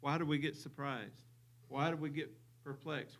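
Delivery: ordinary talk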